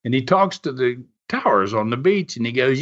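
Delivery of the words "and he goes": ordinary delivery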